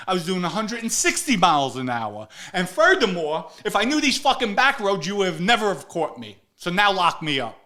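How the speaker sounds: Vinny shouting his defiance at the cop; defiant yell. Retold or imitated. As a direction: throw the boast right back at him, loud.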